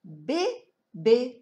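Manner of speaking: "Bébé" as two separate beats; syllable by syllable. Shown as a demonstration. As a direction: sound out "Bé", pause, "bé". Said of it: "On va frapper les syllabes".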